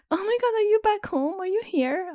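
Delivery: very high, gushing nasal mimicry; mock high-pitched excitement; imitating nasal friend. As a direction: squeal it like the annoying old friend. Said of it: "She talks like this".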